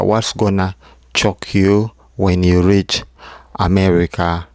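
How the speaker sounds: ordinary talk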